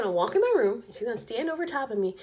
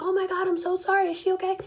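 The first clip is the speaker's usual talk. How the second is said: apologetic man; 9 semitones higher, faster than usual. Retold or imitated. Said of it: "He's like".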